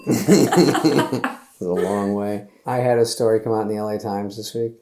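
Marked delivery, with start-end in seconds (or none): 0.1-2.5 drawn out slowly. Acted. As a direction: stretch each word out. Very slow drawn-out.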